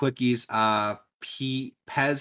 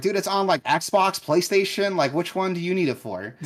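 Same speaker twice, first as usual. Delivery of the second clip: much higher, louder mock exasperation; retold exasperation; 9 semitones higher, 5 dB louder than usual. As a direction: act out a clerk at the end of their patience. Retelling exasperated reply to angry customer.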